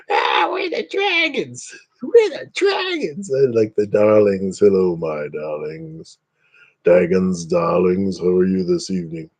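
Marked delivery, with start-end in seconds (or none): 0.1-3.3 very high, slow, mocking growl; silly shouted dragon-gang voice; tough dragon gang. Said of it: "It sounds like all these". 4.6-9.3 lightly voiced genteel hostess; sweet darling greeting. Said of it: "Foxy likes when I talk like that".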